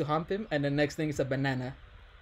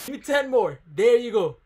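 high, raised coaching shout; urging on; 5 semitones higher, 4 dB louder than usual. Real, not acted. Host demanding ten more from a guy, urging shouts. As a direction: cheer them through the last reps. Egging on someone doing a count.

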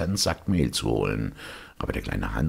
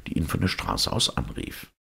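usual speaking voice

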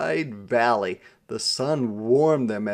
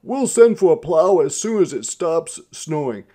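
loud, raised announcer-like delivery; announcing; 6 semitones higher, 9 dB louder than usual. A narrator's reading. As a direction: announce it to all the passengers. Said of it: "he announced".

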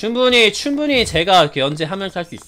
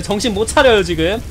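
ordinary talk, nothing marked

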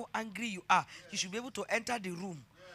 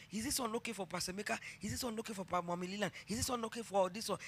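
fast, soft, rushed phone questions; eager child on phone; 8 dB quieter, faster than usual. Retold or imitated. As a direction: hurry the questions like a keen girl. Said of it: "she would call me".

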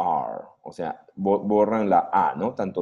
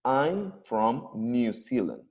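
slow model sentence; much slower than usual. Shown as a demonstration. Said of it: "cuando hablo en la contracción es I'm".

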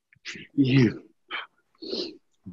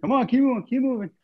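high, urgent coaching call; rally them to keep going; energetic push; 8 semitones higher, faster than usual. Real, not acted. Coach pushing tired class on.

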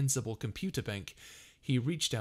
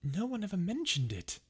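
much higher, irritable retort; irritable Arthur; 10 semitones higher than usual. A narrator's reading. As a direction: snipe back, annoyed. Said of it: "muttered Arthur irritably".

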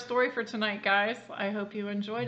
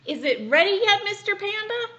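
high, loud chirpy question; high loud eager asking; eager bird voice; 11 semitones higher, 9 dB louder than usual. Acted. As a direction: ask like an impatient little bird.

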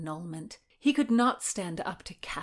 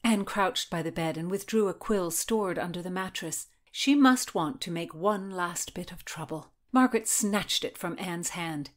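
usual speaking voice

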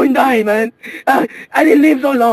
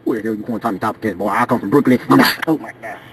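a much lower gruff character voice; lightly voiced grown man; 5 dB quieter than usual. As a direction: play a tough Brooklyn man, lower. Passenger character voice.

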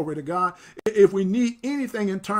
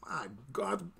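hushed awe; 12 dB quieter than usual. Real, not acted. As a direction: drop to a hushed exclamation mid-reading. Overcome while reading the blessing.

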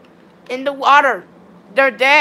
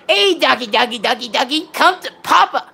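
re-enacted dog call. Gamer retelling how he called the dog, playful dog call. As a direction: imitate yourself calling a doggy over. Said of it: "I was like".

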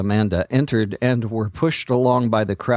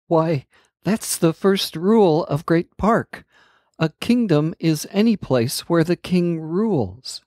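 a lightly voiced earnest girl explaining; gently voiced princess; Amanda's voice; 6 semitones higher than usual. A narrator's reading.

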